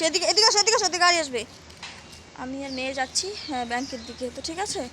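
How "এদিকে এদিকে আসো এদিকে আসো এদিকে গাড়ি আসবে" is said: calling out. Real, not acted. Calling daughter out of traffic.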